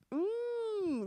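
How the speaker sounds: a high squeaked hmm, caught out; high knowing hum. Retold or imitated. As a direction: hum like you've been caught. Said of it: "because I know all of these things".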